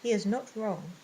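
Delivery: usual speaking voice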